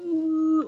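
high, drawn-out motor hum; imitated mechanical hum. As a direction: drone like slow machinery. Imitates slow platform noise.

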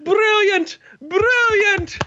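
very high, exultant cry; delighted spectator. Acted. Game master voices the delighted watcher, exultant. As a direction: cheer each one in a high voice. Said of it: "and you hear".

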